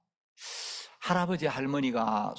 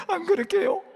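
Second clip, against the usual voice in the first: much higher, small apologetic voice; meek grandpa reply; 5 dB louder than usual. Retold or imitated. Grandfather playing along, pleading meekly in a high voice. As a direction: play the cowed old man. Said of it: "연기를 잘해줘요".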